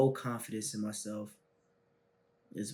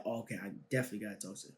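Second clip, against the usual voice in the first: rushed inner thought; 4 semitones lower, 11 dB quieter, much faster than usual. Retold or imitated. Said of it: "So I'm like".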